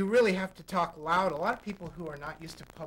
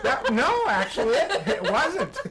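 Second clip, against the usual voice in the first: talking through real laughter, higher and louder; amused, laughing reply; 6 semitones higher, 7 dB louder than usual. Real, not acted. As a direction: reassure while cracking up. Amused by volunteer's overblown greeting.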